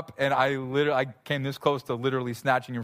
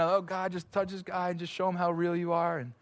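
crying prayer; 5 semitones higher, 4 dB quieter than usual. Retold or imitated. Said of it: "We're both crying".

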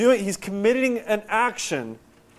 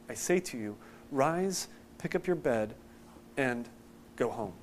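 softer, measured reading from the page; scripture reading; 3 semitones lower, 5 dB quieter than usual. Acted. Preacher reading Mark's account to the congregation, softer scripture reading. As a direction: read the passage quietly and evenly. Reads the passage aloud.

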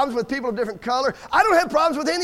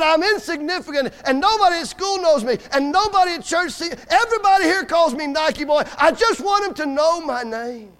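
raised, high-pitched pleading; anguished outburst; 4 semitones higher than usual. Retold or imitated. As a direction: pour out the boy's hurt in a preacher's cry.

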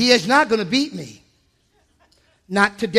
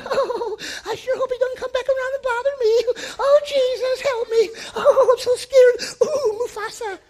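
much higher, whimpering mock-fearful voice; mock scared wimp; 14 semitones higher than usual. Preacher mocking a timid believer, high whimpering voice. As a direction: ham up a cowering coward. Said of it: "and then you go".

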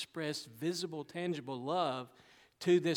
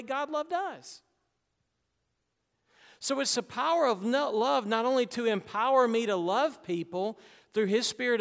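higher, louder pulpit build; fervent preaching; 6 semitones higher, 6 dB louder than usual. Acted. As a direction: lift the room with the message. Preaching at full force.

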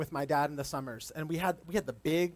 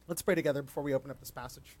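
usual speaking voice

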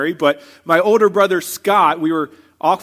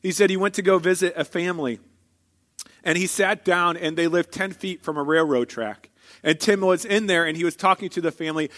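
ordinary delivery

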